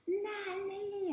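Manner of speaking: high, drawn-out calling shout; shout for Nanny. A narrator's reading. Exasperated tutor shouting for Nanny, high storybook call. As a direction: sing out the name at a high held pitch. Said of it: "And then he shouts".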